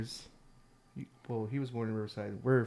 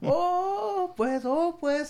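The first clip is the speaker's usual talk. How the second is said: much higher, wailing Texas Mexican "pues"; imitating Texas Mexican accent; much slower than usual. Painter hears he's in California, mock Texas Mexican accent. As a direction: squeal "Oh, pues" like a let-down Texan painter. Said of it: "He kept saying shit like that".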